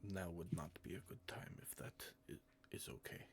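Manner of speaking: fast, hushed whisper; quick apologetic whisper. Acted. Elder lowers voice to apologise.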